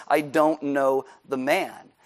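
slow, deliberate, word-by-word denial; direct denial. Acted. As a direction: deny it point-blank, spacing out each word. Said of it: "this time it's now direct".

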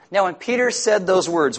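ordinary talk

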